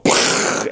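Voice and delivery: unvoiced lip puff for a blast; pfft explosion noise. City blowing up, mock pfft explosion sound. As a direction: puff air through the lips like a tiny explosion. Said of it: "you just see Attilan go".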